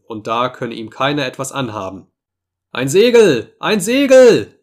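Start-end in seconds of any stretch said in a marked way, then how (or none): 2.7-4.5 high, loud cry from the masthead; lookout's shout. A narrator's reading. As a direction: call it out far across the ship. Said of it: "rief der Utkik-Mann vom großen Top". (lauter Ruf aus dem Ausguck)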